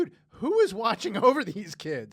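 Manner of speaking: high, loud laughing disbelief; loud, laughing "who is watching these kids"; incredulous outcry. Retold or imitated. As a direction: cry out in amused shock at the kids. Retold disbelief at juvenile court.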